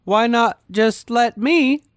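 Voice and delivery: high, slow, wheedling plea; pleading Belle. Acted. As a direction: draw it out like a begging child. Belle asks for sister's kid.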